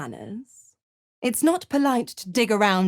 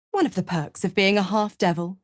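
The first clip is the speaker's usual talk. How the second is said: normal talking voice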